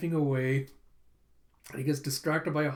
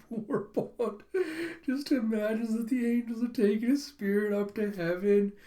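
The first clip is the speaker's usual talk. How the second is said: normal talking voice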